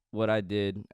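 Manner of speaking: ordinary talk, nothing marked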